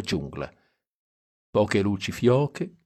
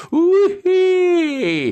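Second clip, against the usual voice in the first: high, loud ecstatic yelp; wild whoop; 7 dB louder than usual. A narrator's reading. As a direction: let out a crazy joyful whoop. Dean whooping about the mambo.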